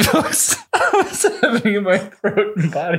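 high laughing voice; barely getting words out laughing; crying with laughter. Real, not acted. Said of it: "Are you crying?".

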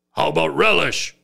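lightly voiced caveman asking; a hint of a hopeful brute; caveman talk. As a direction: caveman Mongo asking for condiments. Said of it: "he still talks like this".

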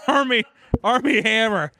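squeaky, embarrassed blurt; high-pitched. Real, not acted. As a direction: voice jumps up high. Stumbling over the awkward name.

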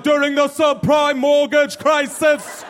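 high, very slow bad Jamaican accent; solemn and slow in a bad Jamaican accent. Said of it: "I gotta do a Jamaican accent".

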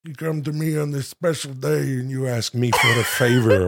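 put-on Austrian accent creeping into Brando; a Godfather line gone Schwarzenegger; Schwarzenegger slip. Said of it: "It turns into Schwarzenegger".

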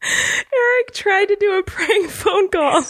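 high, slow confession through laughter; nearly crying with laughter; tearful laughter. Real, not acted. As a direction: squeeze it out while cracking up. Confessing the prank while cracking up.

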